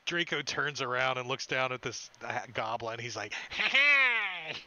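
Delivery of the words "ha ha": high, loud "ha ha"; big hammed-up hero's laugh; mock triumphant laugh. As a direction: laugh down at a tiny foe. Draco looks down at the goblin.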